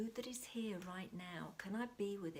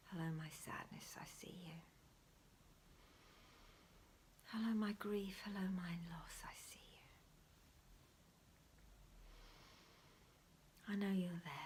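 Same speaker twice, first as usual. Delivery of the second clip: guided meditation whisper; 4 dB quieter than usual. Acted. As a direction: whisper them gently into the breath.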